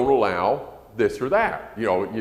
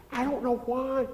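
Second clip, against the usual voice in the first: puzzled mother; 4 dB quieter than usual. Retold or imitated. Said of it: "she didn't know I heard".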